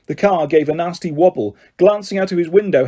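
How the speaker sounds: plain reading voice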